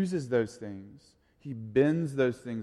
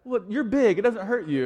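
put-on small boy; 10 semitones higher, 6 dB louder than usual. Son voiced in story.

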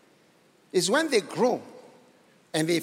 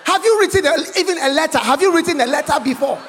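loud, higher, laughing rebuke; mocking challenge; 7 semitones higher, 7 dB louder, faster than usual. Real, not acted. Preacher mocking critic, laughing.

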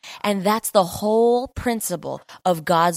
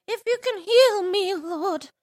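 pleading supplicant; 12 semitones higher than usual. Acted. Desperate man begging Jesus for healing, high whiny plea. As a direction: wail it weakly, far higher than usual.

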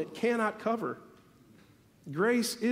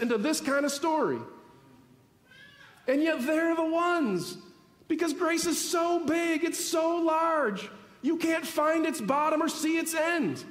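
higher, fuller preaching voice building momentum; worked-up preaching; 5 semitones higher than usual. Real, not acted. Pastor to congregation about grace, worked-up preaching. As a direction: preach it with rising fervour, leaning into the big words. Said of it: "And now I'm getting worked up".

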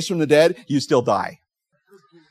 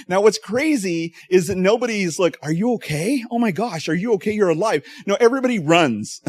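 raised, animated storytelling; excited retelling; 9 semitones higher, 5 dB louder than usual. Real, not acted. Pastor telling a prank video, getting excited. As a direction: pitch climbing as the story gets wild.

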